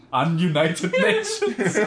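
drawn out, laughing loudly; cracking up mid-word. Real, not acted. Joke said through laughter.

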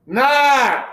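sudden high, loud "Nah!"; loud shout. Real, not acted. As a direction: blurt it out loud. Teacher interjects during show intro.

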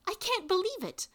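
high-pitched, amazed exclamation; exclaimed. A narrator's reading. Granddaughter amazed to be standing in the yard, exclaiming. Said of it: "I exclaimed".

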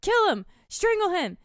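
high, loud mock villain commands; urgent egging on. Chucky telling his body to kill, urgent mock shouting.

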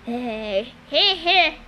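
chortle like a dim henchman; stupid grin. A narrator's reading. Henchman pleased at his orders, grinning stupidly. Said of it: "Orius grinned stupidly".

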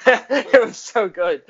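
high, loud, slow laughing delivery; can barely get it out for laughing. Real, not acted. Laughing at the Morgan Freeman bit.

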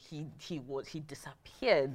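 dropped to a whisper, slow; hushed and slow, almost whispered. Real, not acted. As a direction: say it like sad news. Grave Thanos snap retelling.

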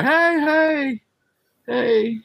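high calling out. Retold or imitated. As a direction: call to friends far away. Calling from cop car.

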